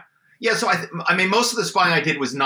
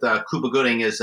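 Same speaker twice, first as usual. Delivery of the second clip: usual speaking voice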